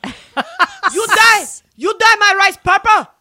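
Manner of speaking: high, loud shocked mother; outraged mom voice. Retold or imitated. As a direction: shriek it like a horrified mom. Mom's outraged voice in story.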